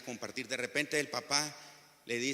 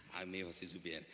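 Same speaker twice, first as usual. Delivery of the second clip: soft, low chuckled aside; chuckle, then say it low and quietly; amused father; 9 semitones lower, 9 dB quieter than usual. Acted. Preacher as father privately amused at his angry son, soft chuckle. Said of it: "como papá se ríe y dice".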